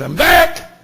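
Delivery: high, loud triumphant call; excited shout. Retold or imitated. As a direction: announce it with a burst of joy. Doctor bursts through kitchen door.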